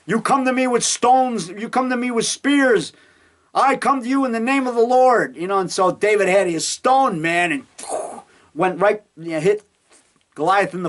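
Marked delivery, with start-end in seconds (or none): 0.1-5.4 higher, proclaiming; David's defiance. Retold or imitated. David challenging Goliath, higher proclaiming. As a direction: declare it boldly to the giant. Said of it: "David said".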